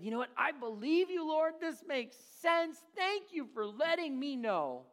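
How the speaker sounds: high, eager mock Noah; hammed-up eager Noah; Noah agreeing. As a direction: gush agreement like a lazy believer. Noah voiced answering God.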